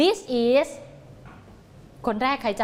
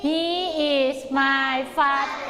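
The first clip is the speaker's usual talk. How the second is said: very slow, word-by-word drill answer; slow choral answer; much slower than usual. Shown as a demonstration. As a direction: lead the class through it slowly.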